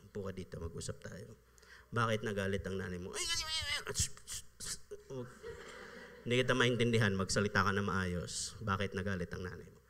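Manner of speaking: sit him down and ask gently; calm father. Retold or imitated. Patient father calmly asking son why mother got angry.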